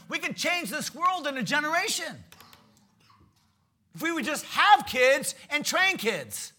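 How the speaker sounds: loud, high, driving preacher's voice; preaching at full force. Acted. Preacher urging the congregation to disciple their children, at full force. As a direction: pound the point home from the pulpit.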